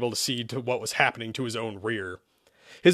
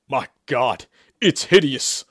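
building to a loud cry; horrified shout; 7 dB louder than usual. A narrator's reading. Exorcist appalled at the uncovered head, shouting. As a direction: cry out in horror. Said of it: "Sherman shouted".

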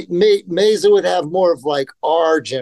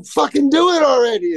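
cocky dare; 11 semitones higher than usual. Retold or imitated. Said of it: "And I go".

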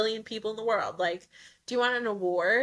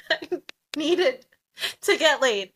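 laughing; 4 semitones higher, 6 dB louder than usual. Real, not acted. Laughing confession.

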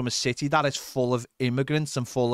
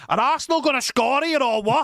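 eager fan; 9 semitones higher than usual. Retold or imitated. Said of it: "I was like".

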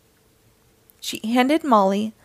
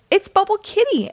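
much higher-pitched, delighted guess; excited exclamation; 10 semitones higher, 3 dB louder than usual. A narrator's reading. Molly guessing the ranger's favorite cat, excited exclamation. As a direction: burst out with the answer. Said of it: "exclaimed Molly".

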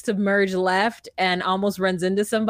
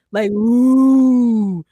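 loud woo; 3 semitones higher, 7 dB louder than usual. Retold or imitated. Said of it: "definitely moo".

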